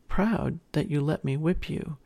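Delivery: ordinary delivery, nothing marked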